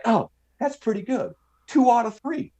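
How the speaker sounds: high-pitched Southern drawl caricature. Retold or imitated. Pinned clerk asking for another round, high Southern drawl. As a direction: angle for a rematch in a high mock Southern drawl. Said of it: "that Southern drawl accent".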